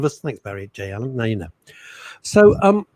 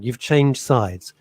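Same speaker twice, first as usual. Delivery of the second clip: drawn-out teasing; much slower than usual. Real, not acted. Teasing co-host about screen side.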